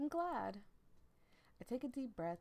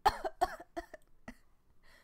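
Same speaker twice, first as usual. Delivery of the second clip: hacking coughs on tea; choking cough; coughing fit; 9 dB louder than usual. Acted. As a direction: sputter and cough as tea goes down the wrong pipe. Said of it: "the tea goes down the wrong pipe".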